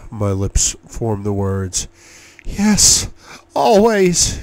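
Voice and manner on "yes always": high, loud cry of passion. A narrator's reading. Lover answering him at the height of passion, crying out. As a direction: call it out high and strong. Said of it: "she cries".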